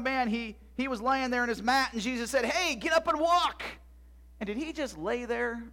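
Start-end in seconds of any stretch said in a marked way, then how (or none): 2.4-3.6 high, loud, drawn-out call; rousing command. Acted. Jesus commanding the man on the mat, rousing. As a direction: call out the order slowly and forcefully.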